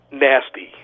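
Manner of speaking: usual speaking voice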